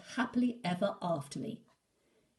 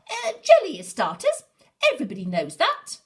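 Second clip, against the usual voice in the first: much higher and much louder, then fast and dismissive; shouted; 11 semitones higher, 14 dB louder than usual. Acted. Little Wolf bluffing to Big Wolf, high loud shout. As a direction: shout it out to hide not knowing. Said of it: "he shouted".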